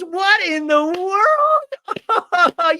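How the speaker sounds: over-the-top high squeal; Brittany. Retold or imitated. Woman reacting to a unicorn gift, hammed-up amazed squeal. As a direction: stretch it out very slow, high and loud. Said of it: "And Brittany goes".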